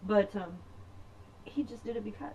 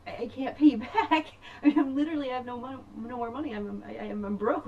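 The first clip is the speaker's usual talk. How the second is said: laughing protest; 8 semitones higher, 4 dB louder than usual. Retold or imitated. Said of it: "And I'm like".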